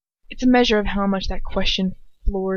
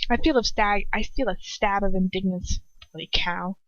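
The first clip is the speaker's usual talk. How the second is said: plain reading voice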